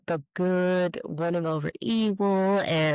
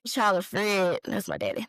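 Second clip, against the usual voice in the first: normal talking voice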